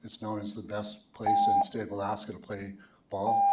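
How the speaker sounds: ordinary delivery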